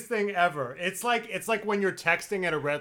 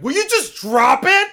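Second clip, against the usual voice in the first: high, loud mock snap; high, loud imitation of a sister snapping; 11 semitones higher, 13 dB louder than usual. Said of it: "she'll be like".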